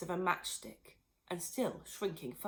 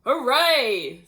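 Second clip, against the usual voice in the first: cheer; 4 semitones higher, 12 dB louder than usual. Acted. Husband cheers grandma gone.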